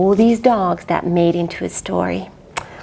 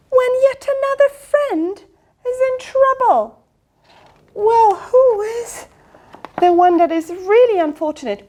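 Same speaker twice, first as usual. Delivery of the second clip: high dramatic ask; 12 semitones higher than usual. Acted. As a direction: ask it with big suspense. Teacher builds suspense.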